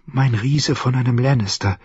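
breathed. Acted. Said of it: "hauchte sie".

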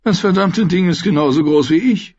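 high-voiced little grumble; grumbling aside. A narrator's reading. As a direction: grumble in a high-pitched character voice. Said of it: "brummte Tyrion leise".

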